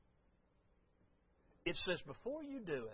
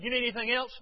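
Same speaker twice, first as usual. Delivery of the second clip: louder, higher curt question; curt brush-off; 7 semitones higher, 9 dB louder, faster than usual. Retold or imitated. Pastor brushing off Lisa while in a hurry, curt. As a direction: snap it out impatiently. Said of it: "with my typical tactful way".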